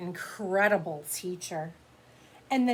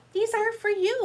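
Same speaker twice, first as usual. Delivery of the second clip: high, louder eager offer; eager child; 13 semitones higher, 6 dB louder than usual. Acted. Lily eagerly giving snacks to Mister Slinger. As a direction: hand over the gift brightly.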